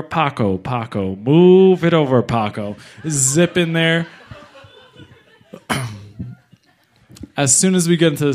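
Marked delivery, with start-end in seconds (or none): 0.0-2.7 loudspeaker. Retold or imitated. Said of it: "He gets on the loudspeaker".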